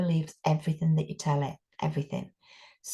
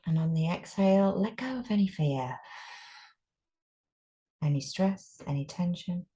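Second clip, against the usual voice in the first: guided meditation. Acted. Teacher guiding a group through breathing, calm meditation voice. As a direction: ease them into stillness.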